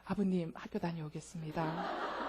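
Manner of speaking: put-on distant child bidding a formal goodbye to father; stiff formal child. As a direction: stiff dutiful child, half laughing. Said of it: "신뢰하지 못하는 아이는요".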